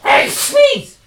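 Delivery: a big sudden sneeze; sneezing. Real, not acted. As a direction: sneeze burst out loud. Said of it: "I do apologise for that".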